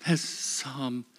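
normal talking voice

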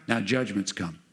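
usual speaking voice

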